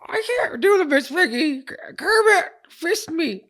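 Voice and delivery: very high, loud pig puppet squeal; Miss Piggy. Acted. Asked for an impression, host squeals as the puppet pig. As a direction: push into a piercingly high diva falsetto. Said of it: "how would you do a Miss Piggy?".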